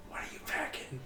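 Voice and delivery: hushed, voiceless aside; whisper. Acted. Player whispering to Kim about his gun, whispered. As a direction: whisper it confidentially to a partner. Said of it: "Whispering to Kim".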